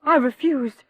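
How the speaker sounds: indignant outburst. Acted. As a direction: snap back in offended pride. Widow defending husband, indignant.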